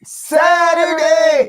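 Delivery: high, very long drawn-out yell; loud, stretched-out announcer-like shout; shouted catchphrase. Pretend. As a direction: holler it to wake someone up. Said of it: "you need to say".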